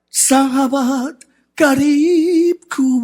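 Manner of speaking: very slow, stretched-out words; drawn-out recitation. Acted. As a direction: draw out each syllable for emphasis. Recites verse for congregation, drawn out.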